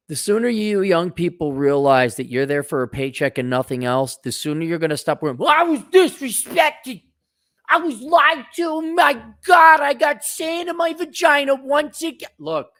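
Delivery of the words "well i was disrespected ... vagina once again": high, slow, squeaky mocking whine; mock whiny complainer. Mocking the complainer in a high whiny voice, ending in a shout. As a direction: sneer at the complainer with a shrill put-on whine.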